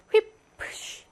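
휘프쉬 with a crisp voiceless "p" before "sh"; hardened p. Shown as a demonstration. Teacher demonstrates devoicing, 휘프쉬 with a voiceless p. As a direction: puff the p in 휘프쉬. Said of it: "이렇게 프 소리가 나고요".

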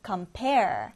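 "compare" with PARE stressed; light com, lean on PARE in "compare"; stress on second syllable. Shown as a demonstration. Teacher reads the word out for learners, "compare" stressed on PARE. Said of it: "뒤듬절의 강세를 두고 읽어보겠습니다".

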